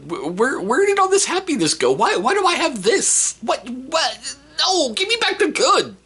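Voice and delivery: high-pitched, hammed-up distressed wail; mock panic. Parent watching the kids grow up, mock panic. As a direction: plead loudly in comic despair.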